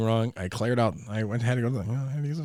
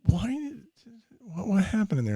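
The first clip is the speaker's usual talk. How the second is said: wife's question; 10 semitones higher than usual. Retold or imitated. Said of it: "My wife's like".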